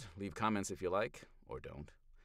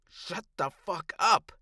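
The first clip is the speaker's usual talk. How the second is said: much higher and louder, slow furious roar; 12 semitones higher, 12 dB louder, much slower than usual. Acted. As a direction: bellow it with everything. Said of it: "she roars".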